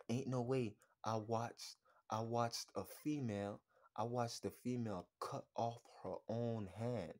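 ordinary talk

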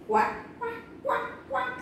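high little quacking; duckling quacks. Acted. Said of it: "says the duckling".

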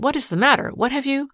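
interrupting her husband in alarm; alarmed question. A narrator's reading. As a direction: break in high, startled. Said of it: "She checked herself in surprise".

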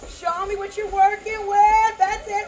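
loud encouraging shout. Real, not acted. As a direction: call it out over the music. Calling out to exercising class.

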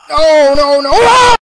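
loud cries rising to a high scream; terrified scream. Real, not acted. Player caught by the monster, screaming in terror. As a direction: scream in panic as it catches you.

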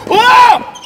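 high ringing cry of joy; excited shout. Real, not acted. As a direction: whoop at the winning shot. Big point cheer.

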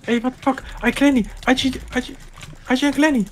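high shocked yelling; outraged shout. Real, not acted. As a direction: scream it in disbelief. Outraged reaction in game.

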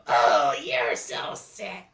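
slow disgusted creature voice; witch voice. Acted. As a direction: drag it out disgusted. Disgusted puppet.

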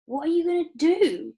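high, loud alarmed question; Charlie crying out. A narrator's reading. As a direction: cry it out, pitched well up. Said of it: "cried Charlie".

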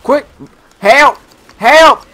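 high, loud rising cries; calling for help. Real, not acted. Player down, needing assistance from teammates, loud cry for help. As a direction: call out for rescue.

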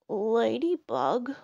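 slow, mildly grumbly bear; grumbling. A narrator's reading. Bear woken by Ladybug, grumbling. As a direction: grumble the name slowly, half asleep. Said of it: "grumbled Bear".

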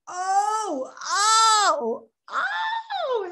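high, drawn-out gasping ohs; hammed-up astonished oh; mock dawning realization. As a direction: act out a sudden revelation, pitch climbing.

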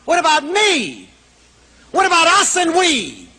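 high, wailing protest; exaggerated indignant appeal; indignant plea. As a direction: push the pitch up, then let it fall away. Acts out scolding the kid.

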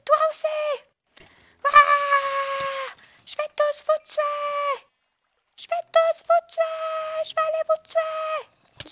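high, loud hammed-up vengeful shouting; mock furious rage. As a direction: scream revenge threats, hammed up. Menace les monstres en criant. (cris de vengeance exagérés)

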